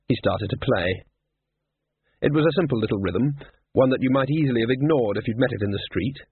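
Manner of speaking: ordinary delivery, nothing marked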